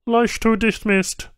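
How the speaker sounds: high, terse Banu tech voice; a clipped alien answer; flat alien tech. A narrator's reading. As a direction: state it plainly, no feeling. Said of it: "the Banu said simply".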